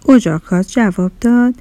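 ordinary delivery, nothing marked

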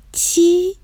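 put-on high, childlike disbelief; surprised high-pitched questioning; astonished little broccoli. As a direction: ask it in a small, amazed cartoon voice. Broccoli astonished at being praised.

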